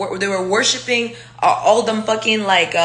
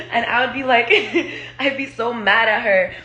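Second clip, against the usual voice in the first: ordinary talk, nothing marked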